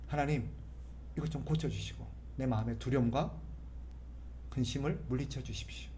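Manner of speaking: soft, calm talking to God; quiet heartfelt prayer; conversational prayer. Shown as a demonstration. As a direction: pray quietly as if chatting. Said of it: "대화하듯이".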